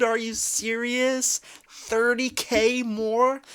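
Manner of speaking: can't believe the number; incredulous. Real, not acted. Reacting to the thirty K, slow incredulous questions. Shocked reading fan's spending.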